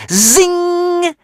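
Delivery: high, loud zing; dart whizz. A narrator's reading. Narrator voicing the flying dart, high sharp zing. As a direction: make the dart zip past.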